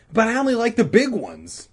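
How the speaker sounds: put-on high, loud kid's protest; lightly voiced boy standing his ground. Boy character protesting to friend.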